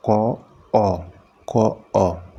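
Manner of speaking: क अ — split the sound, separate parts. Shown as a demonstration. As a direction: say "क, अ" slowly, part by part. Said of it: "और बोल के देखिए". (क और अ अलग-अलग बोलकर)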